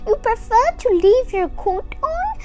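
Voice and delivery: put-on old grandmother voice. A narrator's reading. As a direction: speak up like a kind old lady at the door. Grandmother talking to bear.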